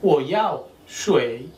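Wo yao shui drawn out slowly; careful slow demo; slow model phrase. Shown as a demonstration. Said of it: "voy a comprar agua".

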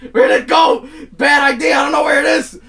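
high frantic worry; panicked. Real, not acted. Lost the wasp after hitting it.

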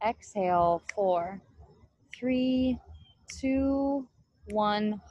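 calm, unhurried counting with long pauses; slow breath counting. Acted. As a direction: count the breaths slowly, like a yoga teacher.

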